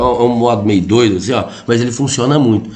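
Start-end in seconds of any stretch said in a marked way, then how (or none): none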